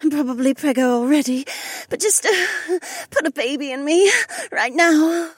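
high, urgent feminine moaning. A narrator's reading. Blonde urging on her lover, moaning. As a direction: plead through moans. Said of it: "the blonde moaned".

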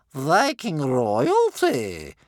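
very slow, high, loud mockery; sarcastic. A narrator's reading. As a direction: draw it out with heavy sarcasm. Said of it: "said Bear Cub's grandmother sarcastically".

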